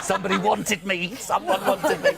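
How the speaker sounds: gleeful. Retold or imitated. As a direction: giggle with delight at being wanted. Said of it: "I just went there and going".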